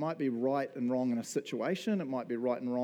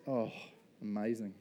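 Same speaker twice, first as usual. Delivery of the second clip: normal talking voice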